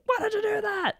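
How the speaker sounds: high-pitched shocked imitation; mock dismay. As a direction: squeak out the hero's alarmed question. Acting out hero's shocked reaction.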